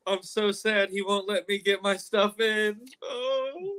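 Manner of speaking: high whiny mock pout; hammed-up poor-me whine; mock sadness. Listener mocks the crying wrestler.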